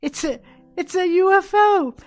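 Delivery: imitated shriek at reduced volume; retold scream. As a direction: reenact screaming in amazement, pitch way up. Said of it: "and I'm screaming".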